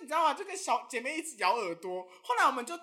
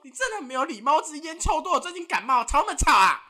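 angry scolding; 9 dB louder than usual. Acted. Angry retort to rude caller.

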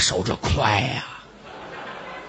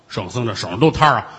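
ordinary delivery

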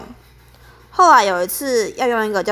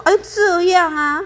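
a mock impatient whine, pitched higher; impatient sigh; 10 semitones higher than usual. Senior grudgingly agrees to teach newbie, annoyed sigh. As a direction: sigh it out like she can't be bothered. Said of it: "非常不耐烦".

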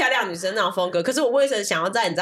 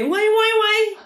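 big theatrical calls, pitched high; hammy stage voice; 12 semitones higher, 4 dB louder, slower than usual. Shown as a demonstration. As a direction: project like a hammy stage actor. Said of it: "舞台腔就是你要".

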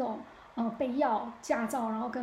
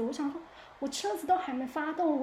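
usual speaking voice

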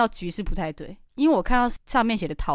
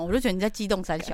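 ordinary delivery

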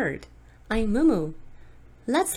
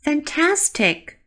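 excited praise; 8 semitones higher, 3 dB louder, slower than usual. Acted. Praising learners' repetition.

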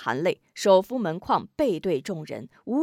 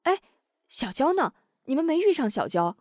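higher, quick worried question; suddenly remember the missing girl; startled asking; sudden realization; 6 semitones higher than usual. Acted. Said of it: "猛然想起贾小娇".

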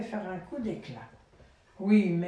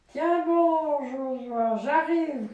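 louder and higher, rallying shout; heroic call; 5 semitones higher, 6 dB louder, slower than usual. Acted. Father voicing the wolf shouting up to Josua, heroic. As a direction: call up the tree to save a friend. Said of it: "lui cria le loup".

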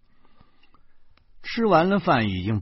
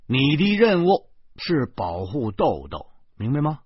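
put-on instructing a child; 5 semitones higher, 6 dB louder than usual. Man assigns child a mission.

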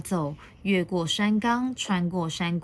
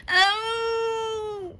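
long, high, steady howl; storybook young-dinosaur wail. A narrator's reading. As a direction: cry out in a sustained high "aooo". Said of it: "他的叫声".

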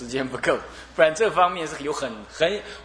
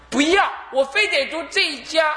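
put-on higher, insistent girl's refusal; stubborn daughter; 10 semitones higher than usual. Daughter refusing her father, stubborn mock whine. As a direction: dig in like a spoiled child.

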